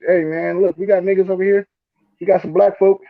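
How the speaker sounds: high, excited hype over the list; mock excited. Reading the Nebraska list to friend, mock excited.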